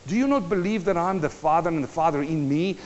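normal talking voice

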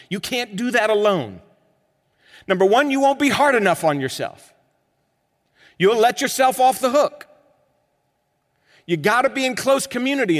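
loud, high exhortation; preaching at full force. Acted. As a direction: press the point hard from the pulpit.

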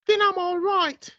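put-on woman's British accent. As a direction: purr it back like a smitten English lady. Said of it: "man and a woman accent".

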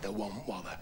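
wife muttering back; soft wife voice. Acted. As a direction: drop it right down.

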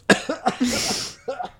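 high strained coughing; coughing fit. Real, not acted. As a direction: cough hard, still choking. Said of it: "Oh, je m'étouffe". (toux aiguë et forcée)

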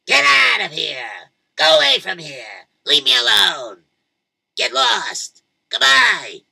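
put-on loud furious old man yelling; angry shout. Old man yells at the kids at his door, furious. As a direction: shout them away from the door.

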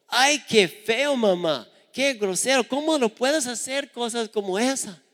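high, disgusted little sister voice; disgusted complaint. Retold or imitated. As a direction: squeal out your disgust. Hermanita se queja de la escena. (hermanita asqueada, voz aguda)

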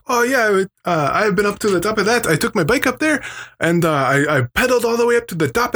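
put-on high, fast, cheerful old guy; high jolly old man voice. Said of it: "he's like".

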